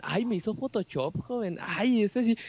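put-on flattered older lady. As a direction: gush like a lady thrilled with her photo. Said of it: "siempre las señoras dicen lo mismo". (imitando a una señora halagada, aguda)

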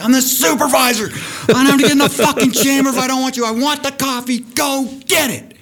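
hammed-up furious boss shouting orders. Supervisor yelling at the tender to fetch coffee, furious shout. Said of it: "And I was furious".

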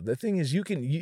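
ordinary delivery

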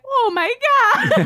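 high, shocked gasp of oh my God. Retold or imitated. Friend retelling hearing porn audio, high shock.

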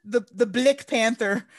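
Blick Panther with an i sound in place of a; mocked accent vowel. Mocking the film's accent, she says Blick Panther with an i. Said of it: "Not an A, it's an I".

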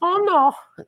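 put-on very high, fretful "oh no" of a worried older Italian woman; Italian mother-in-law voice. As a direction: squeal it way up in mock alarm. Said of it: "Italian mother-in-law's voice".

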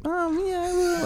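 put-on very high, soft child voice; cute little daughter. Dad imitating his daughter in the back seat, cute and high. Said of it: "being all fucking cute and stuff".

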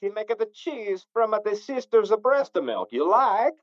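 mock Kazakh comic-foreigner accent; Borat. Offering homemade cheese, in hammed-up Borat accent. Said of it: "then we have the Borat".

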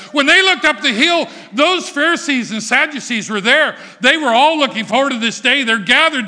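loud, rising, fervent preaching; preaching at full force. Acted. Preacher telling the congregation, full-force preaching. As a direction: build the crowd up to the Messiah. Preacher narrates at high pitch.

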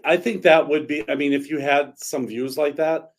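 ordinary talk

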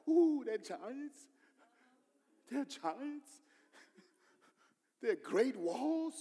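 high, slow mock-frightened ooh; hammed-up scared gasp at the giants; mock fear. Preacher mimicking the frightened crowd, mock fear.

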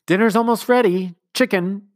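brisk nanny calling out dinner; Nanny's call. A narrator's reading. Nanny calling the household to dinner, brisk.

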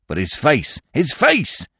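impatient demand. A narrator's reading. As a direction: press urgently for an answer. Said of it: "demanded the detective".

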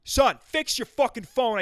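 loud, high, slow cursing father; imagined angry dad. Retold or imitated. Dad ordering son to fix his phone, angry. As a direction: imitate dad hammering out the order. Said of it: "He wanted to say".

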